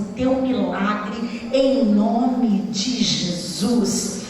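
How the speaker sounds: low, slow, drawn-out proclamation; solemn preaching. Real, not acted. Preacher blessing congregation in Jesus' name, solemn preaching. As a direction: declare the blessing word by word, deep and deliberate.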